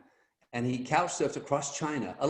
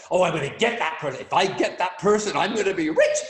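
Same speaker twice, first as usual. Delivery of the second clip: mock greedy scheming, pitched up, laughing at the end; hammed-up greedy thought, louder and higher; greedy inner voice; 4 semitones higher, 5 dB louder than usual. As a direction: play the salesman counting his money before he's earned it, half-laughing. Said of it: "All that kind of nonsense".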